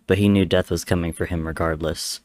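usual speaking voice

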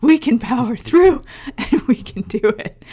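mock gung-ho. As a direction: cheer it like a naive pep talk. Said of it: "we thought".

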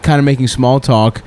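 usual speaking voice

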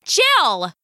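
sharp call. Acted. Mother calls out sharply.

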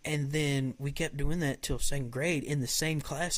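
usual speaking voice

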